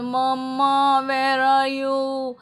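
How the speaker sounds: put-on high, slow, drawn-out child's call; lightly voiced child calling mama. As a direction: stretch it out like a little kid looking for mummy. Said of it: "इधर उधर घूमते हो और बोलते".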